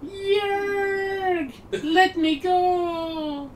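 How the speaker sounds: high, slow, strained cries of a captured woman; Gerudo lady voice. Acted. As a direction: shriek to be let go, drawn out. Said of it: "the Gerudo lady's voice".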